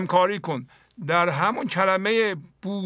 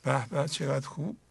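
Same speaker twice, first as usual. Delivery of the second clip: put-on much softer, gently cooing at a child; soft soothing praise; 5 semitones lower, 7 dB quieter than usual. Parent hugging a crying child, softly soothing. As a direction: coo it softly to calm a little one. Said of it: "میری یواش میگی".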